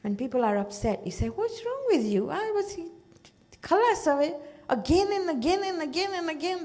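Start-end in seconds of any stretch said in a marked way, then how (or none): 1.3-6.6 high, impatient mock scolding; exasperated. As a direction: toss it off like a fed-up relative. What people say when upset.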